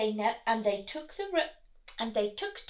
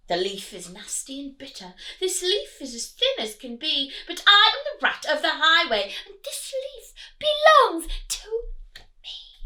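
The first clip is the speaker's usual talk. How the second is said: loud, higher sneering villain; bully them like a greedy rat; big villainous rat; highway rat voice; 7 semitones higher, 7 dB louder than usual. Acted.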